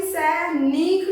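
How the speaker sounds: सैनिक with a drawn-out "neek" ending; long ee. Shown as a demonstration. Teacher giving pupils the wrong dictation, सैनिक with long "neek". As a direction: lengthen the i in सैनिक. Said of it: "ना पे ध्वनि लंबी आ रही है".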